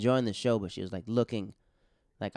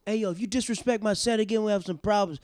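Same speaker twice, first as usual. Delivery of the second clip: friend warning heckler; 11 semitones higher, 6 dB louder than usual. Retold or imitated. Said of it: "my boy back in Florida goes".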